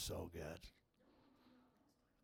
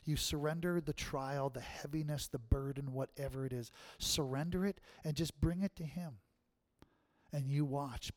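ordinary talk

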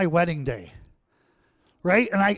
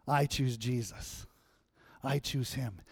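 quiet resolve; 5 semitones lower, 11 dB quieter than usual. Retold or imitated. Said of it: "and you say".

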